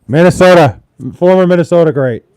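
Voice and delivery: loud ring-announcer call; announcer hype. Acted. Streamer hyping up a former Minnesota great, loud announcer voice.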